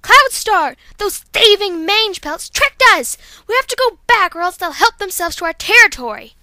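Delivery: loud, high, slowed on the insult; Violet Mist outraged. Acted. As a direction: urge the leader back home. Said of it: "here's Violet Mist".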